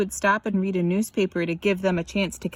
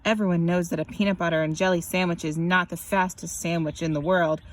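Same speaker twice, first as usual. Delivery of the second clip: ordinary delivery, nothing marked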